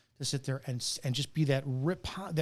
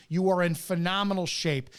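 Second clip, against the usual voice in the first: ordinary delivery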